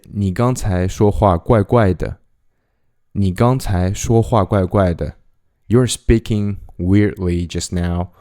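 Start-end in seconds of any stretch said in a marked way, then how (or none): none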